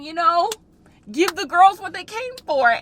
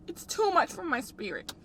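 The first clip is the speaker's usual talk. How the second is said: tearful; 7 dB quieter than usual. Real, not acted. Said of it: "crying in car".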